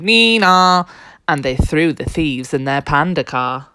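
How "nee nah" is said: loud two-tone police siren; long, loud nee-nah; siren imitation. A narrator's reading. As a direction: wail like a cop car. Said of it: "and they came".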